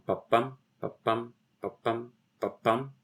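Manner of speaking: "Ba-bum" weak then strong, evenly repeated; iambic beat. Shown as a demonstration. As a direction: tap out "ba-BUM" four times like a metronome. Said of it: "played out there would be an iambic tetrameter".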